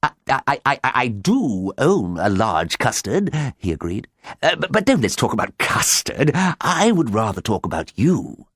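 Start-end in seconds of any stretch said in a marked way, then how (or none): 0.0-3.3 flustered, stuttering start; stammer, then draw the words out slowly; lovestruck stammer. A narrator's reading. Smitten farmer answers the mysterious caller, flustered stammer. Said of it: "quivered as if he had been shot". 4.4-8.5 stammering, overeager suitor; lovestruck fluster. A narrator's reading. Smitten farmer turns talk to his caller, flustered and gushing.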